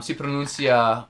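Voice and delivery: ordinary delivery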